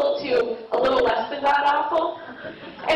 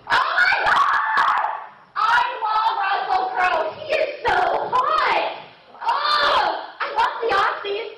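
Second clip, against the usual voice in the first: very high drawn-out mock squeal; squealing fangirl; 8 semitones higher, slower than usual. Girl in cinema swoons at Sherlock Holmes preview, squealing.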